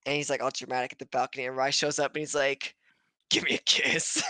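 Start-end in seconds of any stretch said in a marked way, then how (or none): 3.3-4.2 mock breathy come-on. Joking character voice.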